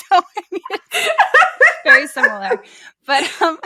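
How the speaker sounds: high, loud words through real laughter; laughing while talking. Real, not acted. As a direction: barely get it out for laughing. Laughing at the shared coincidence.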